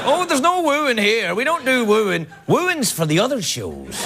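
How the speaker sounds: put-on West Country English, high start, drawn out; slow West of England lilt; west coast of England accent. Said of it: "strange west coast of England accent".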